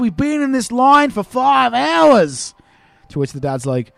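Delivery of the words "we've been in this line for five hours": slow, high, drawn-out whine; mock Aussie whinge; whiny Australian kid. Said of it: "this kid now sighs again".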